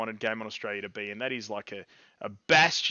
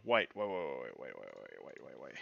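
fading wait; 14 semitones lower, 13 dB quieter than usual. Real, not acted. Emote fails, he trails off.

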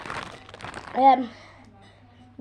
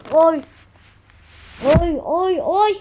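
pained yelps from spicy heat; 3 semitones higher, 8 dB louder, slower than usual. Real, not acted. Tongue burning from chips.

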